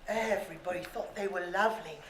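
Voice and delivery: ordinary delivery, nothing marked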